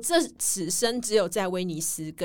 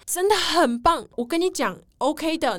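imitating the pushy host; 7 semitones higher, 5 dB louder, slower than usual. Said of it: "他就说".

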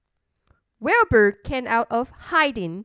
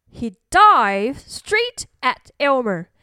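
ordinary delivery, nothing marked

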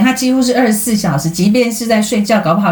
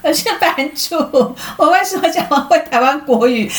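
put-on Taiwanese Mandarin accent; 8 semitones higher than usual.

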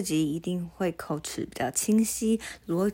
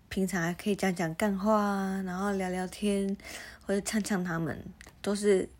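ordinary talk, nothing marked

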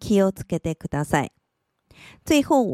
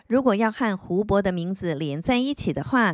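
ordinary delivery

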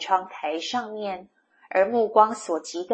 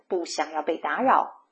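plain reading voice

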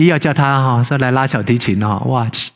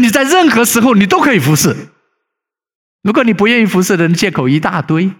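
raised, louder preaching voice; fervent preaching; 9 semitones higher, 3 dB louder than usual. Acted. Pastor warns the congregation against delay, preaching higher and louder. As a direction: drive the warning home from the pulpit.

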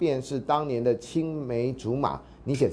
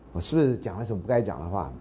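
ordinary talk

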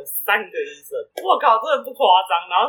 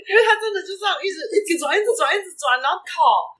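语速快、音调高很多的激动描述; 越说越兴奋地讲孩子打滚; excited; 11 semitones higher than usual. Real, not acted. 激动地描述孩子打滚. (兴奋地讲述)

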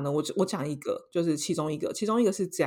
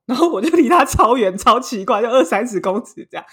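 excited; 4 semitones higher, 8 dB louder than usual. Real, not acted. Excited about fleeing the stranger.

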